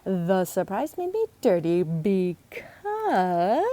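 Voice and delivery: slow, with because stretched long; dragging out because. Acted. Emily stalls Logan by dragging out because. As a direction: draw out the word because to stall. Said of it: "dragging out the word because".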